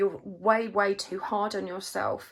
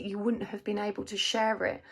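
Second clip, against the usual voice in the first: ordinary talk, nothing marked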